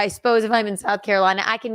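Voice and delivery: ordinary talk, nothing marked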